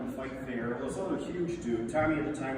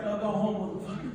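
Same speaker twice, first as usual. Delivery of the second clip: dismissive; 7 semitones higher than usual. Retold or imitated. Tommy voiced dismissing beaten guy.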